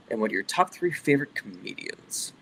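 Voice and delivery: mild Twilight Zone narrator impression; a hint of an old-time TV narrator; Rod Serling. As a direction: read the question like an ominous TV host intro. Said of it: "Rod Serling, can we hear a little?".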